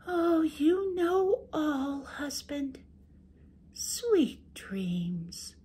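high sweet wife making excuses. Acted. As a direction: play innocent to the ogre, high and gentle. Wife flattering sleepy husband.

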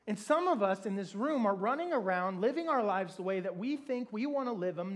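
ordinary delivery

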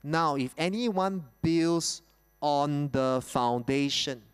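slow, deliberate scripture reading. Shown as a demonstration. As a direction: read it out slowly, word by word. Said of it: "let's read it slowly".

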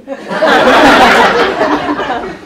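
high loud burst of laughter. Real, not acted. Laughing at his own confession, loud high laugh.

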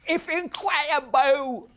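loud, high, slow fake Cockney; bad Cockney accent. Guest repeats the bad accent for the host, hammed-up Cockney. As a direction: stretch it out in terrible Cockney. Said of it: "How about a really bad Cockney".

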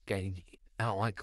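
ordinary talk

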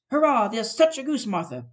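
loud, high excited cheer; children cheering. Acted. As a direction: shout with joy about the goose. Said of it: "cried the two young Cratchits".

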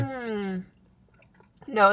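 talking while chewing food. Real, not acted. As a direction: mouth partly full. Seen 2: Woman chews pretzel bite with closed mouth, holds another piece.

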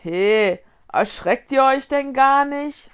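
loud, griping complaint; whiny grumbling ghost. A narrator's reading. Goggling ghost complains nobody is scared, whining. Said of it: "nölte der glotzende Geist".

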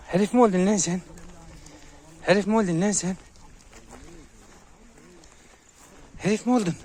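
high, loud teasing of a dog; mock scolding. Real, not acted. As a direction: pitch it up and challenge the pup. Teasing the dog for acting tough.

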